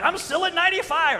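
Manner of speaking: high, smug mock boast from the friend; smug friend quote. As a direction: brag like the unbothered friend. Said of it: "he's like".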